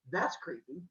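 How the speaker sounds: soft, understated verdict; deadpan reaction. Retold or imitated. She answers Zeus's chair offer, quiet deadpan. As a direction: say it quietly, unimpressed.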